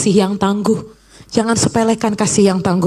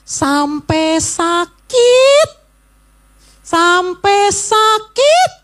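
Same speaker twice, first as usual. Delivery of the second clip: slow, drawn-out sakit; stretched out; 10 semitones higher, much slower than usual. Shown as a demonstration. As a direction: stretch out the words "sampai sakit". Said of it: "Ulangi coba". ("sampai sakit" diucapkan lambat dan panjang)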